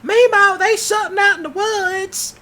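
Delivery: put-on high, loud child's scream for grandma; kid screaming. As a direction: yell it running home scared. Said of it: "they'll run back home screaming".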